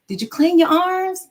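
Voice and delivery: mom yelling. Retold or imitated. Mom yelling to her son in the shower, loud and high. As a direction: call it out to the bathroom. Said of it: "what I would have to yell".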